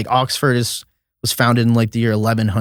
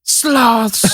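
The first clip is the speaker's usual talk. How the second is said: high, drawn-out single word; documentary narrator; 12 semitones higher than usual. Retold or imitated. Imitating the narrator naming sloths, high and drawn out. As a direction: announce the animal with mock wonder. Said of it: "and then he'll just go".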